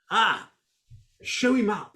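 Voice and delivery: usual speaking voice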